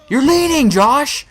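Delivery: loud, high-pitched mock outburst; acted-out exasperated yell; exasperated retold accusation. Acted accusation at rafting partner.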